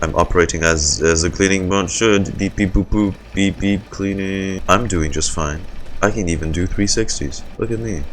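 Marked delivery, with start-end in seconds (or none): 2.4-4.6 robot voice. Acted. Robot cover act.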